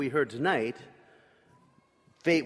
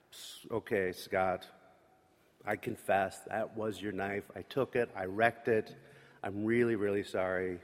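soft, lower, halting apology; remorseful confession; 5 semitones lower, 5 dB quieter than usual. Retold or imitated. Boy confessing to his friend Scott and apologizing. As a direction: own up quietly, ashamed.

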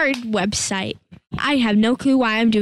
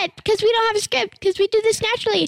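excited panic; 9 semitones higher than usual. Real, not acted. Kid panicking to viewers about losing the recording, rushed. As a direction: blurt it out all at once.

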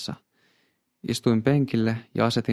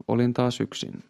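ordinary delivery

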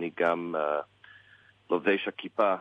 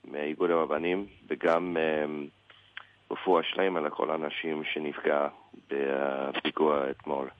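American-accented Hebrew; english accent in hebrew. Real, not acted. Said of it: "שהעברית שלי לא כל כך טובה". (עברית במבטא אמריקאי כבד)

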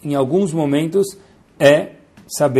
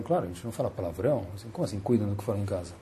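hushed couple; 6 semitones lower, 14 dB quieter, faster than usual. Acted. Couple answers the Rav.

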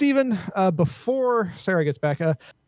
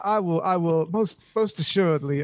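ordinary talk

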